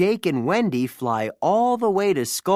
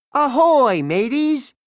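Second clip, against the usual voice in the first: much higher, loud hearty hail; cheery pirate greeting; 9 semitones higher, 6 dB louder than usual. A narrator's reading. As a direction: burst in greeting the crew. Peter Pan arrives triumphantly.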